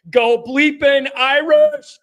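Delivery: big slow rallying cry; rousing cheer. Acted. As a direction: belt it out like a stadium chant. Reading fan's cheer on stream.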